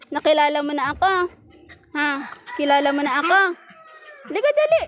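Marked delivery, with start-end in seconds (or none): 4.2-4.8 very high come-here call to a pet; high-pitched call to the pet to come closer; high coaxing call. Real, not acted. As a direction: call the pet over sweetly. Calling the pet over.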